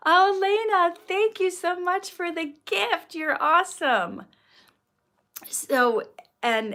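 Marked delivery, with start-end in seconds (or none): 0.1-4.2 high, loud burst of gratitude; thrilled, raised-pitch thank-you; delighted thanks. Real, not acted. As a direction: gush thanks to a gift-giver, high and loud. Thanks viewer for a gift.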